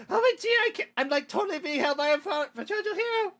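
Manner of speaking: very high, gushing fangirl voice; hammed-up, high-pitched squeal. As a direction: gush breathlessly over the hero. Fangirl gushing over hero.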